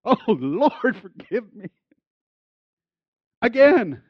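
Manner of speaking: a half-laughing, hammed-up plea to God; mock altar prayer.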